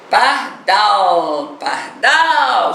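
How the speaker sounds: pardal with DAL stretched long, rising then falling; calling out the word. Shown as a demonstration. Teacher calling the word to find its stress, long-held DAL in pardal. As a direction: call out par-DAAAL, holding the last syllable. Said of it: "eu vou descobrir chamando".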